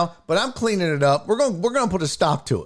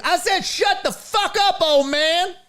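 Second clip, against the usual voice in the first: much higher hammed-up yell of a retort; mock furious Dion; 14 semitones higher than usual. Host playing Dion shouting down Jerry, much higher mock rage. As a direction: blow up at the old man, overdone. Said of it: "Dion don't cuss".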